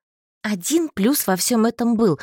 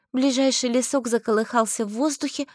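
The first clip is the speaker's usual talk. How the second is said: usual speaking voice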